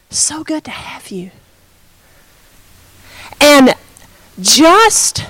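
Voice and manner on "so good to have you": very soft, whispered welcome; gentle whisper. Retold or imitated. Preacher welcoming a broken man, gentle whisper. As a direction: whisper a tender greeting.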